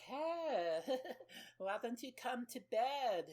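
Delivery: high, put-on woman's voice with a giggle; mock come-on. Woman luring a man to bed, mock high coo. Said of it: "and she's like".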